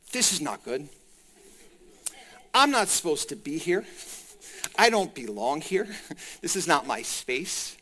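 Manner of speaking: low, subdued mock dread; acted fright. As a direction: play a man uneasily out of place, voice held down. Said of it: "He's frightened".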